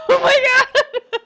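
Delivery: high, very loud panic; panicked cry. Acted. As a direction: shriek it in terror. Panic as the tiger roars.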